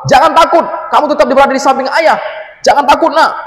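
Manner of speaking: high, loud call telling him not to fear; father shouting comfort in the storm; urgent reassuring shout. Acted. (teriakan ayah menenangkan, keras dan tinggi)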